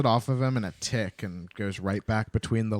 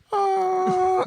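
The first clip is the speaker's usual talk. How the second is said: high, thin drawn-out yell; long high wail of a lone charger; distant battle cry; 3 dB louder than usual. Acted. One private charging at a whole army, high drawn-out cry. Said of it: "one person just going like".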